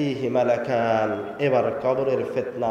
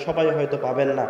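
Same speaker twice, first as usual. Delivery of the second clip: ordinary delivery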